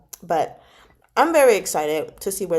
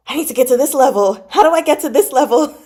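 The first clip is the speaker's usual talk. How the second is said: eager wish; 4 semitones higher, 6 dB louder, faster than usual. Retold or imitated. Longing inner quote.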